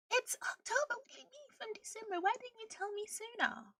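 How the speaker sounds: high-pitched retold protest; exasperated complaint. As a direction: complain in a high, put-upon voice. Retold complaint to power company.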